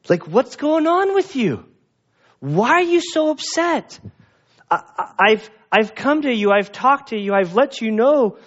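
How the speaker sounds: God asking Cain, hurt and puzzled; pleading God voice. Acted.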